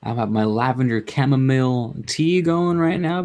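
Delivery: ordinary talk, nothing marked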